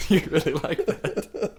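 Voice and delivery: slow, amused through laughter; teasing the co-host, laughing. Real, not acted. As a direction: say it slowly while still laughing.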